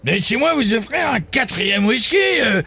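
high, laughing crude comic; Jean-Marie. Acted. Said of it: "un ami des grosses têtes, Jean-Marie".

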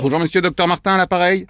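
low, official phone introduction; put-on doctor. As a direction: drop your voice to play the doctor. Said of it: "le docteur vient d'arriver".

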